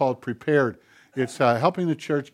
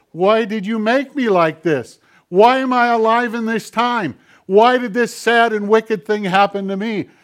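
loud, high, anguished demands; angry cries of why aimed at heaven; shouting at God; 6 semitones higher, 8 dB louder than usual. Retold or imitated. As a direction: hurl the questions up at God. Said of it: "we're shouting at God".

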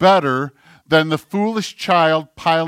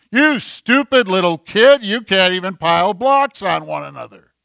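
berating parent; 3 semitones higher, 3 dB louder than usual. Acted. Parent scolding a toddler over falling blocks, harshly. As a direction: lay into a small child with loud, exasperated contempt.

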